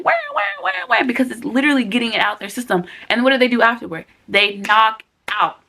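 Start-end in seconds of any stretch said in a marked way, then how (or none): 0.0-1.0 high wailing mock baby cry; imitating baby cry. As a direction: wail like a crying newborn. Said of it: "And they cry a lot more, like".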